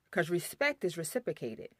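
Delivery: ordinary delivery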